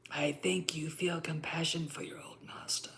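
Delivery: soft sinister whisper; Emperor impression. The Emperor quizzing Vader about Obi-Wan, in a soft sinister whisper.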